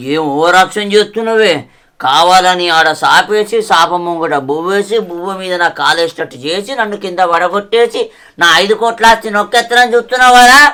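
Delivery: put-on loud drunken rant at his wife; angry rant. Drunk husband accusing his wife of plotting, loud rant. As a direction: bellow the accusations.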